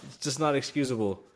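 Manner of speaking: ordinary talk, nothing marked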